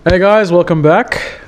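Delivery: normal talking voice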